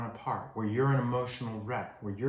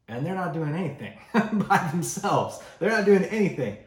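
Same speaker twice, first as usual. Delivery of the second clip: laughing aside; 7 semitones higher, 7 dB louder than usual. Real, not acted. Laughing aside about kid.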